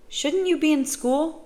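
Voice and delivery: put-on high girlish question; puzzled child, pitched well up; young girl. A narrator's reading. Girl questions the boy about school, high and puzzled.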